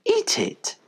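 high, lightly voiced dog mother. A narrator's reading. Mother dog answering her puppies, in a high voice.

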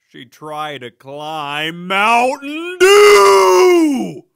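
slow, loud, stretched-out shout; punchline yell. Acted. Yelling the punchline at the audience, slow and loud. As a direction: belt the punchline out slowly.

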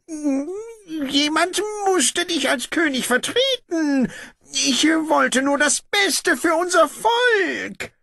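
high, slow, strained voice of the false king; pleading usurper. Acted. Brother defends himself to the returned king, high and strained. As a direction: plead your case as the deposed usurper.

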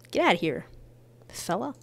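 ordinary talk

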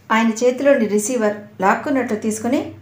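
ordinary delivery, nothing marked

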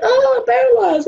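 high-pitched retold cry of alarm; mock panic. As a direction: wail it high, acting out your own panic. Retold panic at numb legs.

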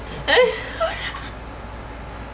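giggling. Real, not acted. Giggling at crude remark.

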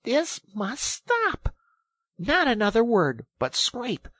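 high, emphatic, loud command; really angry. A narrator's reading. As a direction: put a stop to the arguing. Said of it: "really angry".

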